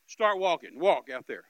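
loud gunman's order; retold robber marching him into the cornfield, loud; barked order. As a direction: push him out with a bark.

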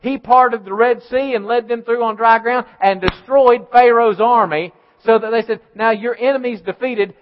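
loud, driving preacher's cry; fiery preaching. Acted. Pastor thundering at his church in full preaching voice. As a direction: preach it at full force to the congregation.

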